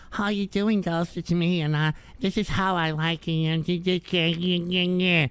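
lightly voiced wimpy fan, trailing off; mocking a weak man. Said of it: "Most of you literally talk like this".

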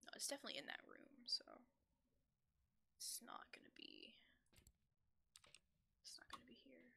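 hushed unvoiced whisper. Real, not acted. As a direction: whisper so the ghost won't hear. Whispering near the ghost.

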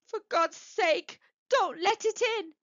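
high, fearful plea; trembling cry. A narrator's reading. Old man begging his wife not to open up, trembling. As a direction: beg in dread, pitched well up. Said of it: "cried the old man, trembling".

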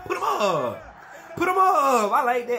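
loud, high laughing cheer; crowd-style hype with laughs; laughing hype shout. Real, not acted. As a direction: cheer the hit through laughter. Laughing hype at big hit.

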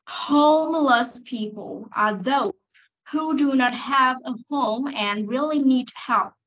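slow, careful English model reading. Shown as a demonstration. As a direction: read it slowly for the class. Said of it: "Cô đọc lại câu hai". (đọc mẫu chậm rãi)

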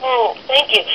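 ordinary talk

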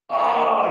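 loud, drawn-out "Ahh" of mock frustration; frustrated cry. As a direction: cry out as an exasperated preacher would. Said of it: "crying out in frustration".

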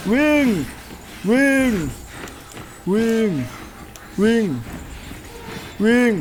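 frantic shouts while fleeing a monster; panicked shouting. Real, not acted. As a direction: scream at yourself to run.

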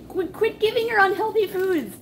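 high, loud protest; exasperated scolding. Real, not acted. Scolding co-host over dog treats.

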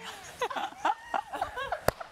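high-pitched burst of laughter. Real, not acted. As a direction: laugh out freely. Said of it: "I'm making them all laugh".

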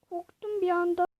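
normal talking voice

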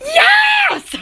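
high, stretched squeal of yes; mock-ecstatic yes. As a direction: squeal it like accepting a proposal. Practicing reactions for marriage.

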